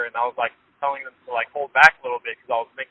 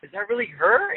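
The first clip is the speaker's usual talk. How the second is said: puzzled friend; 7 semitones higher, 4 dB quieter than usual. Retold or imitated. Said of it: "like, puzzled".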